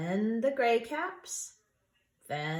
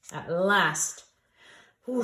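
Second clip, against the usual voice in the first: usual speaking voice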